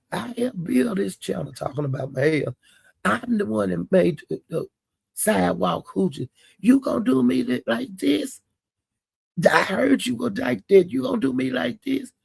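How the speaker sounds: high, whiny imitation of a crying rant; mock tearful complaint. Cupcake crying on live at Mahia, imitated high and whiny. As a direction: pitch it up like a hurt drunk friend.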